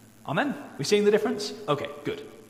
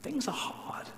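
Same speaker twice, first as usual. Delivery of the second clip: whisper; 12 semitones higher, 5 dB quieter than usual. Acted. Prayer of lament, voiced.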